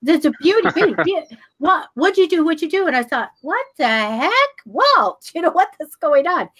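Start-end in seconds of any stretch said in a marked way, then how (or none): 0.0-2.9 higher-pitched excited babble, repeating yourself; amazed pestering. Retold or imitated. Acted pestering friend, amazed at her. 3.4-6.5 higher-pitched mock disbelief with a laugh; bewildered exclamation. Acted bewildered reaction to friend.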